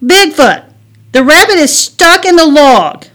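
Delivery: high, loud shout for help; urgent plea. Acted. Girl asking Bigfoot to save rabbit, urgent shout. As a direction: shout it up to a giant.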